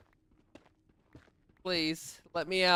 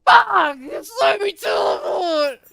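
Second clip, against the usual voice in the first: high, loud frustrated scream; furious shout; 12 semitones higher, 9 dB louder, slower than usual. Real, not acted. Streamer screaming at failed teleport, furious shout. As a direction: explode at the game in a high yell.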